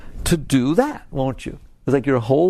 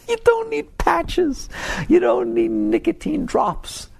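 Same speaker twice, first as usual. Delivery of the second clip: higher, slow, half-laughing scoff; amused scoffing; slower than usual. Real, not acted. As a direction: scoff at it while still laughing. Mocking the quit-smoking aids.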